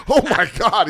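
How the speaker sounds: high, loud excited gasp. Retold or imitated. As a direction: blurt it out thrilled. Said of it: "I was like".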